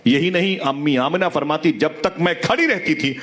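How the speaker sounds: storytelling preacher, voice rising and falling with emotion; impassioned sermon. Acted. Preacher to the gathered congregation, impassioned storytelling. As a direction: tell it to move the congregation to tears.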